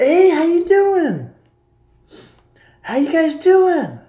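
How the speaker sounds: high, slow, sing-song Southern greeting; put-on Southern accent. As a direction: drawl a friendly Southern hello, up high. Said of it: "I put an accent on for them".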